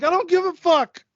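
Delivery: a hammed-up yell at a chatty clerk; mock yell. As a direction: blurt it out in a mock outburst at the clerk. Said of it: "I was like".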